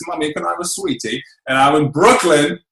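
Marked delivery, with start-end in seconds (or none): 0.1-1.2 put-on soft British child voice asking mum; lightly voiced little British kid; a hint of a small English boy asking for sweets; childhood UK accent. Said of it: "used to talk like this".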